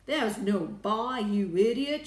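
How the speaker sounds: slow, very loud rebuke; angry shout. A narrator's reading. Ground Control scolding the astronaut, angry shout. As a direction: shout it slowly, exasperated. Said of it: "shouted Ground Control".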